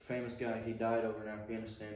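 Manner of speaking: normal talking voice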